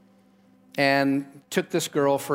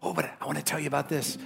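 fast, hushed tempting voice; quick gossipy aside; 6 dB quieter, much faster than usual. Retold or imitated. Gossip pushing a story on the listener, fast and hushed. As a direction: rush it like someone itching to share gossip.

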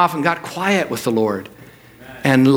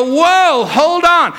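startled whoa; 11 semitones higher, 4 dB louder, much slower than usual. Retold or imitated. Pastor's own alarmed reaction.

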